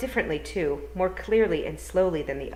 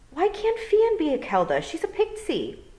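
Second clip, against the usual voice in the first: put-on higher, puzzled girl's voice; young girl Tiffany; 14 semitones higher, 6 dB louder than usual. Tiffany asking the toad, higher puzzled girl voice.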